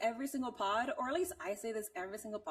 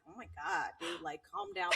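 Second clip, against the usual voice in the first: softer mock-snooty telling-off; pretentious; 5 semitones lower, 5 dB quieter than usual. Imitating a pretentious critic telling someone to chill, pretentious. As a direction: put on a mildly pretentious, hushed complaint.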